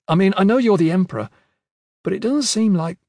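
nervous rush. A narrator's reading. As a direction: hurry it out flustered. Kip nervously fumbling question.

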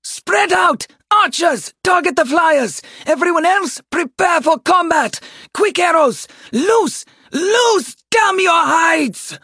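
high, loud battlefield commands; full-force commander's shout; shouted orders. Acted. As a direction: bellow urgent orders to the troops. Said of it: "Call heard more impacts and shouted".

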